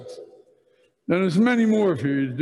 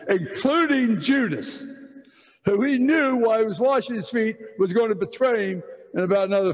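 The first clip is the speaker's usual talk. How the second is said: higher-pitched, fervent preacher's push; rising preaching; 4 semitones higher than usual. Acted. To the men, urging humility in a raised preaching voice. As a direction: drive the point home to the congregation.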